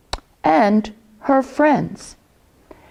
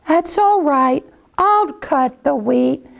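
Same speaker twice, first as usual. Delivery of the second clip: high mother hen; 6 semitones higher than usual. Acted. Hen resigned, does it herself.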